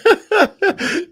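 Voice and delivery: big, high burst of laughter; loud laugh. Real, not acted. Host cracking up at the lightning story, a loud high laugh. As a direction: crack up loudly.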